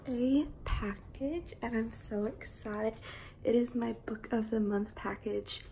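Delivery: soft, half-whispered chat; hushed morning voice. Real, not acted. Unboxing a book package early morning, hushed. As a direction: keep it hushed so nobody wakes.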